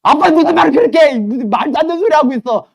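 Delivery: put-on high, loud indignant girl; protesting child. Daughter protesting to her dad and showing her bruises, high indignant.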